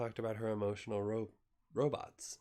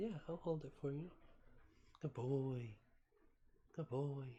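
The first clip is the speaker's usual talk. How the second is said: higher, softer coaxing; pet talk; 3 semitones higher, 5 dB quieter than usual. Real, not acted. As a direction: soothe the cat gently.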